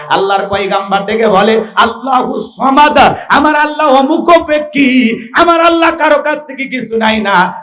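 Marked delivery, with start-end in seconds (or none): 1.8-7.5 high, ringing declaration of faith; prophet's proclamation. Acted. Prophet answers Karun about God, high and proclaiming. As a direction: proclaim it to the whole crowd.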